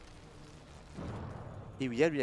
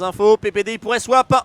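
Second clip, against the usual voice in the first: excited caster; 6 semitones higher, 13 dB louder than usual. Real, not acted. Fight about to start.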